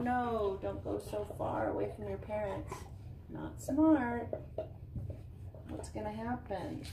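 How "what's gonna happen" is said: ordinary delivery, nothing marked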